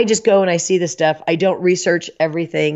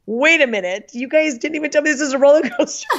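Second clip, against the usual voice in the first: higher and fast, retelling the shock; mock shocked realization; 8 semitones higher than usual. On the coaster, she realizes her friends tricked her, higher and fast. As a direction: squeal it out fast, caught off guard. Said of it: "I'm like".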